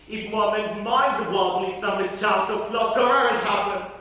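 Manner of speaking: loud, high, slow urgent call; urgent shout. Acted. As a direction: rouse them with force. Urging haste amid unrest.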